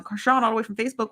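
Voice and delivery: ordinary talk, nothing marked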